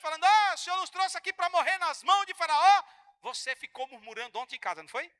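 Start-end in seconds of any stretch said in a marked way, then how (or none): 0.2-2.8 loud whiny grumble, higher; mocking a grumbling people; mock complaining shout. Pastor voices murmuring people.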